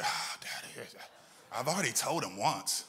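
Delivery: lightly mocked child whining to dad; whiny kid. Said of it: "when I send my kids".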